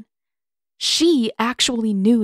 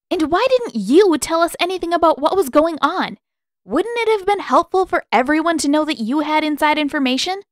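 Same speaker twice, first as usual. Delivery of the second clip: higher, louder reproach; indignant; 9 semitones higher, 5 dB louder than usual. A narrator's reading. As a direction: demand an answer, arms crossed. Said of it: "Katara turned on Pakku too, arms crossed".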